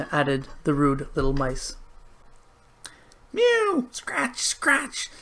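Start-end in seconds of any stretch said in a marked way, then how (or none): 3.3-5.1 very high mew and scratch; frantic cat at the sill. A narrator's reading. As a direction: paw and mew at the window. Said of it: "scuffled Simpkin".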